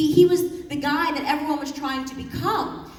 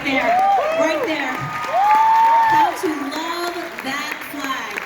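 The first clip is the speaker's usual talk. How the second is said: slow, loud, soaring rally cry; loud rallying call about the flag; rousing shout; 5 semitones higher, 5 dB louder, much slower than usual. Real, not acted. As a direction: lift the crowd with it, slow and loud. Rousing crowd, pointing to flag.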